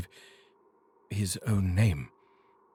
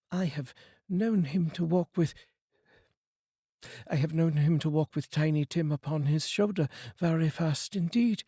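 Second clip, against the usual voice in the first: gentle mother; 7 semitones higher than usual. Acted. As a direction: let the mother's voice catch with love. Mrs Cratchit remembers Bob.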